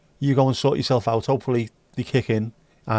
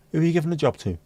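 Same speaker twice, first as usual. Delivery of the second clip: normal talking voice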